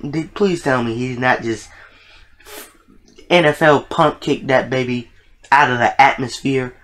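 normal talking voice

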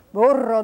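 "burro" loud with a rolled R; Spanish "burro", emphatic trill on the R. Shown as a demonstration. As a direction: roll the R firmly in "burro". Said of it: "that's got that trilled R".

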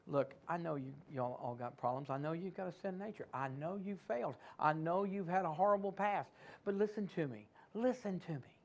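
a hushed, intimate God, reaching out to a listener with problems; voicing God. Preacher voicing God to his people, hushed and low. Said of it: "then what God's saying is".

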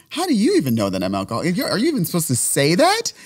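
sputtering with indignation; outraged protest. Retold or imitated. Protesting to Sabina, outraged and fast.

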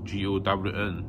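G O W N spelled letter by letter. Shown as a demonstration. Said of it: "sometimes a gown".

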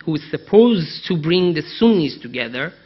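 ordinary talk, nothing marked

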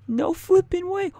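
high, slow gasp of disbelief; stunned disbelief. Real, not acted. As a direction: squeal it out, can't believe the score. Huge score, stunned disbelief.

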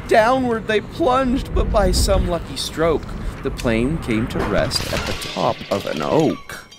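plain reading voice

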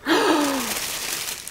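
long, high, soft gasp; astonished gasp. Real, not acted. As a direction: draw in a long stunned breath. Opens another big gift.

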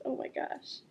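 ordinary delivery, nothing marked

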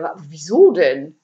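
loud, bewildered stutter; stammering. A narrator's reading. Burglar can't understand the empty house, stammering. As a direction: trip on the first sound. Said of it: "stammelte Ede".

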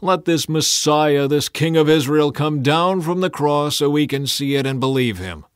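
mock him loudly, daring him down; scoffing. A narrator's reading. Priests taunting Jesus on the cross, loud scoffing dare. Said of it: "they scoffed".

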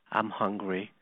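low, soft matter-of-fact reply; hushed, unbudging answer; quietly defiant. Retold or imitated. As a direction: voice a son calmly standing his ground. Defiant son answers his parents.

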